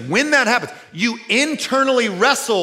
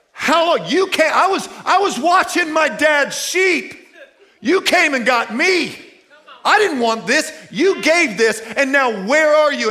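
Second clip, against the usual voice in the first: anguished cry to God; 7 semitones higher than usual. Acted. David alone in a cave, shouting raised complaint to God. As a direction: cry out like a man abandoned in a cave.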